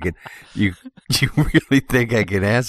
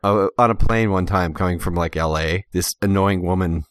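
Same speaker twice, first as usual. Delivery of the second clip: ordinary talk, nothing marked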